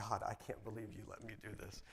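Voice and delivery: softly voiced, wondering thanks; hushed awe. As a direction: drop to a soft, private prayer. Awed thanks to God, retold.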